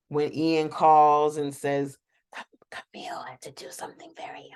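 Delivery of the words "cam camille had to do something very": very soft, whispery drunk imitation; hushed mock-drunk mumbling; sloshed whisper. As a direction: whisper it like a tipsy excuse. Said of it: "she's just sloshed".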